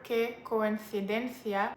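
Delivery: Castilian Spanish, c said as th; slow Spain-accented coincidencia, with a th sound. Shown as a demonstration. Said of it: "leaning towards the accent from Spain".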